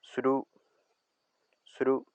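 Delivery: sru said on a high Bribri tone. Shown as a demonstration. Teacher demonstrating the high tone on sru. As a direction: keep sru high and level. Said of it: "El ascendente corresponde al tono alto".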